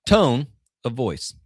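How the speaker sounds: TONE hit loud and high, then "of voice" dropping away; stress on TONE. Shown as a demonstration. Coach showing how emphasis changes a sentence, stress on TONE. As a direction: punch the word "tone", drawn out slowly. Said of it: "if I were to emphasize".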